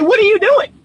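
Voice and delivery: high, disbelieving squeal; incredulous. Acted. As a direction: act stunned at the absurdity. Coach laughing at bad relationships.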